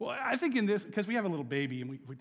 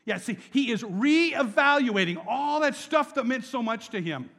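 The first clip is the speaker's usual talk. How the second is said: ordinary talk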